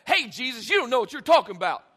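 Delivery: loud, rapid scolding; mock confrontational outburst; harsh rebuke. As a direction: fire it off as a blunt challenge. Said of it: "it's not like a".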